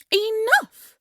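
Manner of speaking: loud, high commanding shout; enraged queen yelling; furious shout. Acted. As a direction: bellow to silence her. Queen cuts off servant's excuses.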